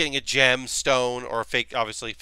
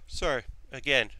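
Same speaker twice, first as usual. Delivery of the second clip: ordinary delivery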